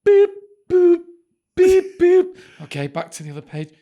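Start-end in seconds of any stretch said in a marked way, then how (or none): 0.0-2.4 loud, high imitated button tones; keypad beeps. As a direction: beep out each key press loudly. Imitates keying a long number.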